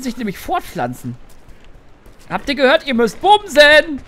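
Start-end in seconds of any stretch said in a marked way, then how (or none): 2.3-4.0 mock announcement. Addresses deer herd in game.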